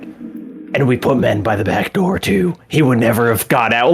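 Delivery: a hint of a grim Batman. Imitating Todo after a kill, lightly done Batman voice. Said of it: "like he's doing his Batman voice".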